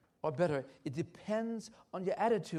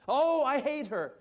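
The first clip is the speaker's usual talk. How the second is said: bitter outburst; 7 semitones higher, 10 dB louder than usual. Retold or imitated. Wife's bitter outburst quoted.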